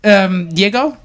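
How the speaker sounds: high-pitched caller fumbling a name, half laughing; high, amused mock phone caller; confused caller. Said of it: "So I have the conversation at the end".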